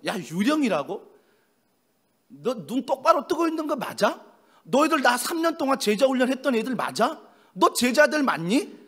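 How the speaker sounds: loud, high-pitched telling-off; scolding. Pretend. Preacher imagining himself as the Lord scolding the disciples, scolding. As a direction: rattle off indignant questions like a teacher scolding pupils. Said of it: "라고 야단칠 때인데".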